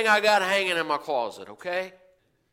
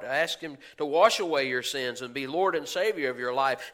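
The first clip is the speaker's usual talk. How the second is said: usual speaking voice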